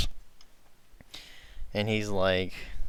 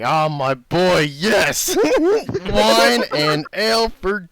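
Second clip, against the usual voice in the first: loud, high, jolly innkeeper on his knee; booming innkeeper; 9 semitones higher, 6 dB louder, slower than usual. Acted. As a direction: boom it out like a giddy giant barkeep. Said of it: "he's just like".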